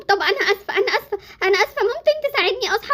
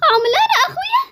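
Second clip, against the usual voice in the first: much higher gasping shout; shocked cry; 9 semitones higher than usual. Acted. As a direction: gasp in shock at seeing your brother. Gasp seeing her brother. (صرخة مفاجأة وخوف)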